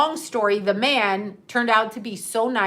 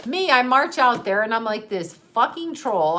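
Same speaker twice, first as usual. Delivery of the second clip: ordinary talk, nothing marked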